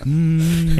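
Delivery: long idle hum; humming. Retold or imitated. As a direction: hum absent-mindedly on one held note. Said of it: "I had started to hum".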